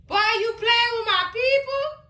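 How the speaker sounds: high indignant singsong; outraged singsong. Real, not acted. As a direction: sing it out in mock outrage. Outraged at threat to family.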